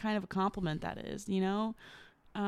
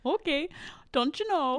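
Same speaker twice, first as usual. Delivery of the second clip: high, playful Midwestern lilt; teasing imitation of a Midwestern accent; 8 semitones higher, 3 dB louder than usual. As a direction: put on a Midwestern accent, pitched up. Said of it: "You start to sound".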